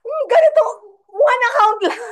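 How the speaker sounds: retold gasp of amazement; excited outburst. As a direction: blurt it out amazed, near a shout. Retelling own shocked reaction.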